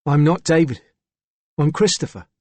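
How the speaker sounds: put-on young lad with a Cockney accent; Cockney accent, boyish voice. A narrator's reading. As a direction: say it as a Cockney schoolboy. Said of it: "it was a boy with a Cockney accent".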